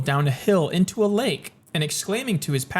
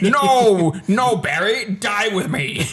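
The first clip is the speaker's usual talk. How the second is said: Jimmy Stewart impression; 4 semitones higher, 4 dB louder, much slower than usual. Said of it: "Jimmy Stewart".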